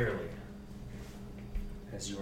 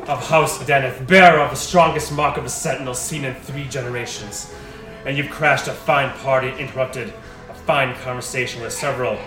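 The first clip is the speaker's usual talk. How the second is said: loud, higher, proud nobleman; strong male voice; 5 semitones higher, 16 dB louder than usual. Acted. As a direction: declare it like an outraged patriarch. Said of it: "a strong male voice".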